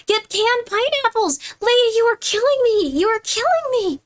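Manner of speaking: can't believe the price, exasperated; shocked disbelief. Real, not acted. Outraged by four-dollar pineapple.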